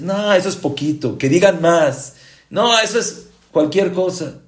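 high, loud dismissive protest; mock dissatisfaction. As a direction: wave it away loudly as far too little. Imitating someone wanting more praise.

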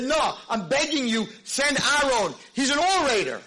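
high and loud, pleading for mercy; desperate begging. Retold or imitated. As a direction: beg as if your life depends on it. Moshe begging God to send Aaron.

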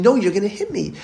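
put-on high, insistent child's protest, not loud; mock screaming boy. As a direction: imitate a panicked boy insisting. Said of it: "he gets louder and louder, and he's screaming".

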